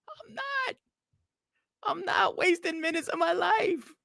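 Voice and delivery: high mocking. Mocking his own refusal in a squeaky voice. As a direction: parody yourself in a squeaky voice.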